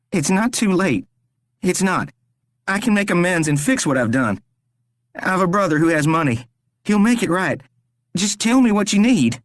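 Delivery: high, desperate begging; pleading captive Rory. A narrator's reading. Rory begs the stranger to spare him, desperate.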